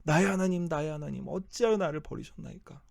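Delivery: a forsaken cry, pitch leaping up; anguished cry. Acted. Preacher voicing Jesus on the cross, anguished cry. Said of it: "예수님께서 그 역할을 담당하시는".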